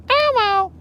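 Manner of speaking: high squeaky puppet voice; Elmo voice. Pretend. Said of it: "Les Elmo? Nah, bro".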